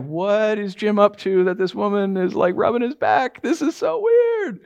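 baffled disbelief. Retold or imitated. Dinner guest watching a stranger rub Jim's back, baffled.

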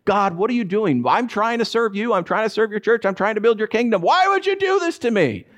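high-pitched pleading protest, climbing to a peak; building from frustrated appeal to high wail; exasperated prayer. Retold or imitated. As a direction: retell an angry complaint to God, pitch soaring at the end. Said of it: "I'm over here praying like".

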